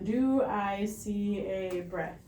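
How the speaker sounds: drawn-out, evenly paced words at half speed; real slow. Shown as a demonstration. Rescuer watches the chest for five to ten seconds, real slow. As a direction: say it slowly to yourself while watching. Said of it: "Um, real slow".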